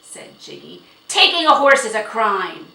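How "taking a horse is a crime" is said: very loud, raised bluster; angry accusation. A narrator's reading. Horse owner calling the borrowing a crime, angry shout. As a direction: thunder the charge at them.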